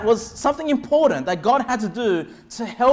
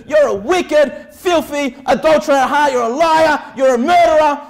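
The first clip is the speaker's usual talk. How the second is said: accusing shout; 4 semitones higher, 6 dB louder than usual. Retold or imitated. Preacher retelling another soul winner yelling accusations. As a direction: hammer the sinner with each charge. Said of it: "this is what he emphasizes".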